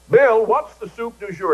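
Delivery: high, loud, drawn-out holler to a colleague; calling out. Retold or imitated. Comedian voicing phone clerk yelling to Bill in the kitchen, calling out high and slow. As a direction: imitate hollering a question across a kitchen. Retold woman calls out to kitchen.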